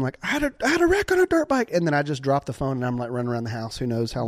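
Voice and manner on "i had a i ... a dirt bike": high, fast panicked yell; retold scream. Boy screaming to his sister that he crashed, high and fast. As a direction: blurt it out high and rushed. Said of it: "I scream".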